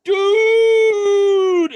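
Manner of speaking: put-on high, loud drunken "Dude!"; excited shout. Drunk guy on a bench hails the walker, loud shout. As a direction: yell it like a drunk frat boy hailing a friend. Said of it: "a guy sitting on the bench goes".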